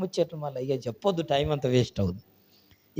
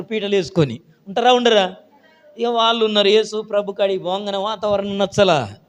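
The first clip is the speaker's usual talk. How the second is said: ordinary delivery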